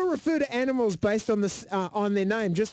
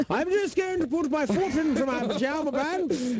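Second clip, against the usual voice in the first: mock posh; 7 semitones higher than usual. Mocking posh pajama talk.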